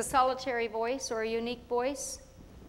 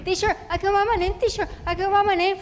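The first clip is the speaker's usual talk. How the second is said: excited; 8 semitones higher, 7 dB louder, faster than usual. Retold or imitated. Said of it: "child begging for attention".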